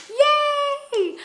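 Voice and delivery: high loud yay; excited high cheer. Real, not acted. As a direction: squeal it with delight. Cheering her finished drum.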